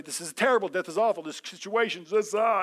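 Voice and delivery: mock dread building to a wail; mock panic. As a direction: wring your hands over death in jest. Fearful believer voiced in sermon.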